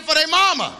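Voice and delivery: ordinary talk, nothing marked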